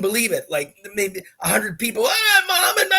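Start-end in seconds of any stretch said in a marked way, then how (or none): 2.1-2.9 high, loud fan cry; imitated crowd scream. Storyteller voices crowd spotting Muhammad, imitated screaming. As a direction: mimic screaming fans mobbing their hero. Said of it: "everybody's screaming".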